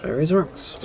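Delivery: usual speaking voice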